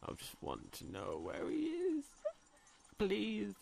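very soft, squeaky high plea; small, hushed begging; plaintive pleading. Real, not acted. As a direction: beg in a tiny high voice. Pleading for the monster's location.

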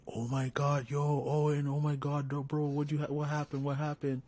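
shocked crowd voices. Retold or imitated. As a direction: play the stunned classmates crying out. Classmates shocked after the punch.

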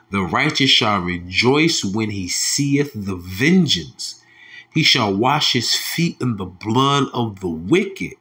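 slow, solemn psalm reading; slow preaching of the verse; scripture reading. Acted. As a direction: pronounce judgment from the scripture.

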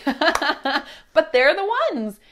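slow, amused laugh into the line; chuckling, drawn out. Real, not acted. Laughs about big steps.

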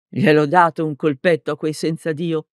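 ordinary delivery

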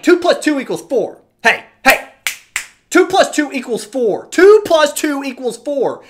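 forceful mock teacher repeating a basic fact; emphatic drilling. Acts drilling a pupil.

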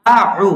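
আউ with a sharp আ and a soft pressed ain; আউ, alif then soft ain. Shown as a demonstration. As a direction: press আউ gently from the throat. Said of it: "আলিফ এবং আইন আছে".

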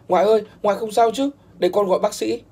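a grandson calls out as his grandfather's heart gives out, alarmed. Acted. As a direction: rush to the grandfather in panic.

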